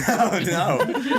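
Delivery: ordinary talk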